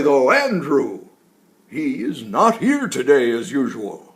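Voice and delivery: put-on loud, jolly old tree voice; woody tree voice. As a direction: speak like a giant, cheerful old tree. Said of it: "deep, woody-sounding words came out".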